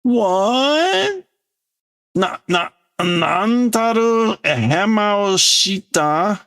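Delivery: put-on very high, slow, furious old ruler; a ruler raging at his failed servant. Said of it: "in anger".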